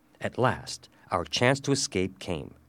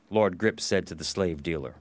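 ordinary delivery

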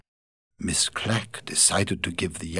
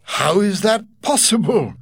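higher, louder disbelief; astonished Godfrey; 12 semitones higher, 6 dB louder than usual. Acted. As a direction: react in shock to her words. Asked Godfrey.

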